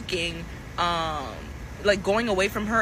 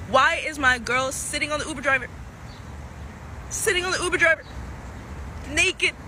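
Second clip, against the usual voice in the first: shocked disbelief; 5 semitones higher than usual. Retold or imitated. Shocked at girl with driver.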